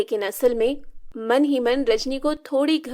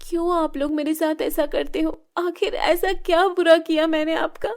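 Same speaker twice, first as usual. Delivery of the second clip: put-on higher, crying girl's voice; tearful complaint; 6 semitones higher than usual. Shilpa asking her stepmother why she is tormented, tearful. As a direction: ask through tears.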